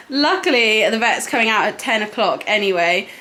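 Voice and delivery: normal talking voice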